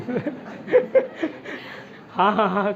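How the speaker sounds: real laughter breaking into the words; laughing. Real, not acted. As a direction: tease the class and crack up laughing. Teacher jokes with class, laughs. (हँसते हुए)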